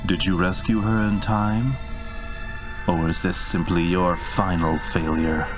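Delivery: ordinary delivery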